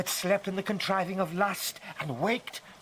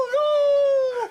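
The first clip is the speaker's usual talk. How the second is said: very high, loud wailing hello; mad shriek; 8 dB louder than usual. Acted. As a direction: scream it like a lunatic.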